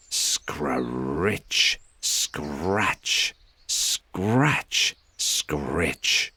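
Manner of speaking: imitated scraping hoe noise; hoe scratching. Narrator mimics the gardener's hoe Peter hears, scratchy sound effect. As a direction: scrape the words like a hoe in soil. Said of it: "he heard the noise of a hoe".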